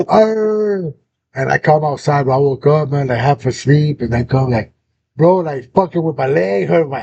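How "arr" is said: long, high mock howl; dog howl. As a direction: imitate a dog howling on and on. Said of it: "These fools were howling".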